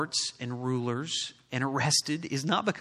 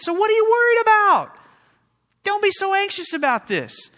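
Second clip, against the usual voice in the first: very loud, high, rising to a shout; shouted pulpit challenge; preaching climax; 10 dB louder than usual. Real, not acted. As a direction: build and cry it out to the room. Preacher building to a peak.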